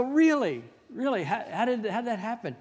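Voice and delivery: high, astonished double take; mock disbelief. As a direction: act stunned, pitch jumping up. Retold disbelief at quick engagement.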